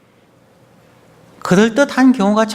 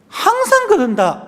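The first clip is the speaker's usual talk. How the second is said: mock complaint pitched way up high; exasperated retort; 13 semitones higher than usual. Family member answering a casual remark about food, exasperated retort. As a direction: throw back a high-pitched, fed-up retort. Acted snappy retort between spouses.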